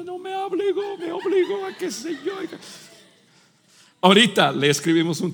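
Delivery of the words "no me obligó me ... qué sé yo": high, laughing whiny complaint; whiny crying kid. Retold or imitated. Son complaining to his mother, mock whiny. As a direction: tattle like a sobbing child. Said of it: "llorando contigo a decirme".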